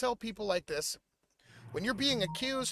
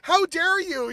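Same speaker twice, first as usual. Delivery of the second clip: higher and louder, offended retort; imitated outraged "how dare you"; indignant; 8 semitones higher, 6 dB louder than usual. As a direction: fire back like you've been insulted. Retelling his indignant reaction.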